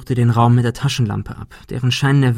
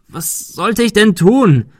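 viel höher und lauter, langsam herausgepresst; verzweifelter Ausruf; 10 semitones higher, 5 dB louder, slower than usual. A narrator's reading. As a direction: aus der Verzweiflung herausschreien. Ben verzweifelt verteidigt sich. (Ben ruft Monika verzweifelt und laut zu)

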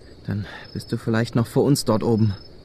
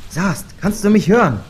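calling out; 7 semitones higher, 5 dB louder than usual. Acted. Ruft nach dem bewusstlosen Sast.

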